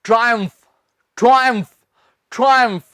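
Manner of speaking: ट्रायम्फ with a "try" opening; two syllables in ट्रायम्फ, first like "try"; correct pronunciation. Shown as a demonstration. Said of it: "मेरे साथ बोलिए".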